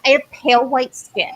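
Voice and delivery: ordinary talk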